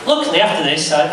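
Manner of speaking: ordinary talk, nothing marked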